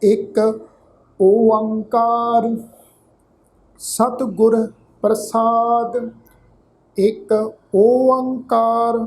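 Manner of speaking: slow, drawn-out sacred reading; scripture recitation. Shown as a demonstration. Teacher models the scripture reading for the congregation, slow recitation. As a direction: recite it slowly, holding the long vowels.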